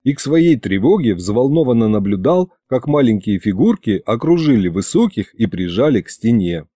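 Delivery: usual speaking voice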